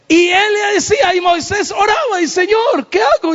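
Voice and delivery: high shouted preaching; preaching at full force. Acted. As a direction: lift the voice and cry out to the congregation.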